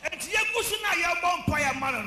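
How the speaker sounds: high, loud preaching cry; preaching shout. Real, not acted. Preacher crying out to the church, loud and high.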